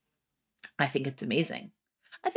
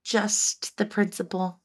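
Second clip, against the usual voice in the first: high self-questioning; 5 semitones higher, slower than usual. Retold or imitated. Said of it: "I'm gonna be like".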